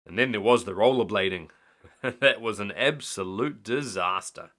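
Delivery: ordinary delivery, nothing marked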